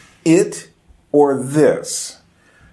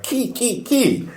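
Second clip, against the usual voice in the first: much higher, quick ki ki ki; excited ki; 11 semitones higher, 4 dB quieter, slower than usual. Retold or imitated. Imitating Georgians saying yes excitedly, pitched high. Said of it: "feeling a bit more excited".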